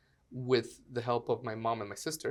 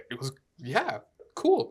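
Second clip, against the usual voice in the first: overly polite; 5 dB louder than usual. Retold or imitated. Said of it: "stop being Canadian with me".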